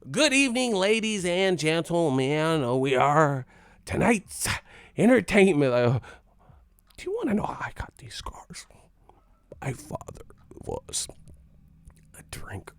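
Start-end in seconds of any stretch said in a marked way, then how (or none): 0.1-5.7 high, slow showman villain voice; hammed-up Joker speech; Joker impression. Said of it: "this motherfucker the Joker". 6.9-12.8 whispered menacing villain voice; creepy whispered Joker imitation. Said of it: "the Joker".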